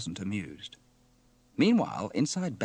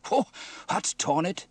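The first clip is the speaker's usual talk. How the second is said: exasperated, raised-pitch grumble; muttered dismay; 9 semitones higher, 6 dB louder than usual. Acted. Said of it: "he muttered".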